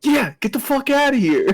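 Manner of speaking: high-pitched, playful shooing; joking dismissal. Retold or imitated. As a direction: chase them out in jest.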